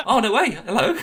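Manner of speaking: high, loud laughing surprise; surprised delight. Retold or imitated. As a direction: act thrilled to be recognised. Her surprised reply to waiter.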